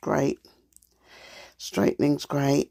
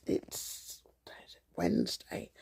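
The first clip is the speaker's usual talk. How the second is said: whisper; 9 semitones lower, 11 dB quieter, slower than usual. Real, not acted. Whispered day name.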